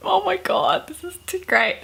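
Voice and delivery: high, thrilled delight. Real, not acted. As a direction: gush over how good it is. Delighted by the story.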